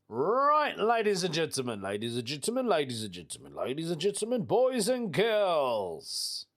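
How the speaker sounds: rolling ringmaster call, pitch swooping down and up; showman announcer. Pretend. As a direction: announce it like a showman, stretching the last words. Showman's intro to favourite segment.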